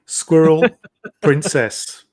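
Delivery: ordinary talk, nothing marked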